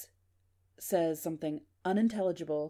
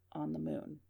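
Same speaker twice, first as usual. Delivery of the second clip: low, soft, distant murmur, lightly voiced; 3 semitones lower, 9 dB quieter than usual. The strange voice answers on the recording, a low faint murmur. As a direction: murmur it like a signal fading out. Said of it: "Very low".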